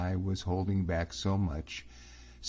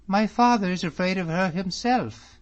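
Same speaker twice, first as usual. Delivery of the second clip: put-on high, bright-eyed girl's voice, louder; young lady's voice; 12 semitones higher, 7 dB louder than usual. A narrator's reading. Miss Drummond chatting to David about the ladies, high and lively. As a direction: voice her a lot higher than the narrator. Same character.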